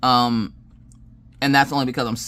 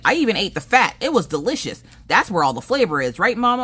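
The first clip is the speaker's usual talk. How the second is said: put-on excited kid voice, higher pitch; imitated proud child; 8 semitones higher, 4 dB louder than usual. Daughter boasts to mama about dinner, proudly imitated. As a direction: brag like a pleased child.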